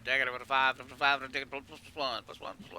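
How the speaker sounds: lightly voiced auction patter; quick auction chant, half-joking; auctioneer. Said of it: "Gotta be like an auctioneer".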